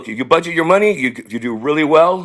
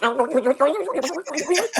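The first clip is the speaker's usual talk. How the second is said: high, fast rewinding gibberish; vocal rewind effect, high-pitched chatter; tape rewind noise; 11 semitones higher, 6 dB quieter than usual. Pretend. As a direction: imitate a tape squealing backwards. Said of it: "okay, I'll rewind myself".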